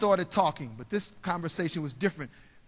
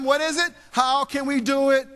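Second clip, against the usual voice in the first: louder, higher, an urgent driving question; excited; 5 semitones higher, 6 dB louder than usual. Retold or imitated. Salesman driving home, asking himself in excitement. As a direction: fire the question like it won't let you rest. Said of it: "He was so excited".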